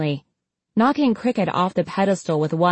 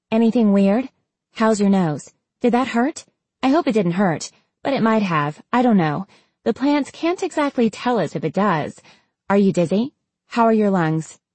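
higher-pitched, rambling concern; anxious questioning; 4 semitones higher than usual. Acted. Experimenter checking on her patient's symptoms, anxious rambling. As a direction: fire off worried questions in a higher voice.

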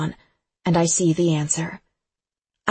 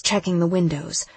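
plain reading voice

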